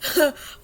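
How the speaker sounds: high burst of laughter; giggling; laughing. Real, not acted. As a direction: let a short laugh break out. Laughs at the memory.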